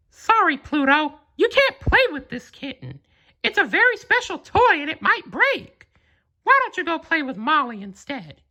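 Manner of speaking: very high, cheerful cartoon mouse voice; high-pitched Mickey Mouse talking to his dog; Mickey's voice. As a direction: squeak it out like a friendly cartoon mouse.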